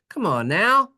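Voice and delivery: ordinary talk, nothing marked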